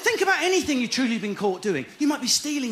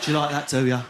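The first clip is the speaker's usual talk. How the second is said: cross-eyed porn model; 8 semitones lower than usual. Pretend. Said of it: "Imagine that".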